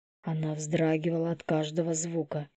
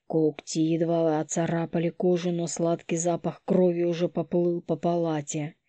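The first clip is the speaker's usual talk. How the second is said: plain reading voice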